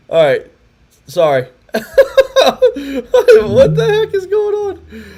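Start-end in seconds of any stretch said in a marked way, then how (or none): 1.7-5.0 much higher, slow, laughing; laughing disbelief. Real, not acted. Host baffled by another failed call, laughing slowly. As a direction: drag the question out mid-laugh. Skype call failing again.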